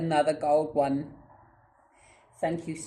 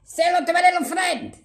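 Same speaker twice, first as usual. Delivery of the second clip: loud, high movie-quote bellow; hammed-up high shout; shouted catchphrase; 13 semitones higher, 7 dB louder than usual. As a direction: belt it out like an action hero. Film catchphrase while brandishing a tool.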